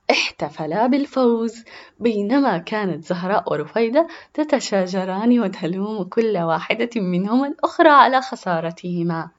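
usual speaking voice

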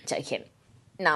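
ordinary talk, nothing marked

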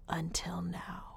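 dramatic hushed whisper. Acted. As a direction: whisper it like a mystery. Teasing a mystery.

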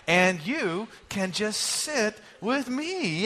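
slow, unison recital of "And you can just sit with me"; said slowly together. Shown as a demonstration. Pastor leading congregation in unison, slow and deliberate. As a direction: lead the room through it, word by word, slowly. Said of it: "Let's say it together".

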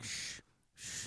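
hushed shh, no voice; shushing whisper. Retold or imitated. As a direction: shush them under your breath. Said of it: "he did the other thing where he's like".

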